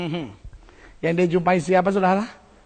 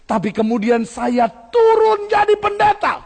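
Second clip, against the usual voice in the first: "turun" said higher and slow, louder, as the witness's own lament; the witness complaining he came down to pastor, much higher and slow; quoted witness; 11 semitones higher, 3 dB louder, slower than usual. Retold or imitated. Witness at the pulpit lamenting, high and slow. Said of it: "tapi dia bilang".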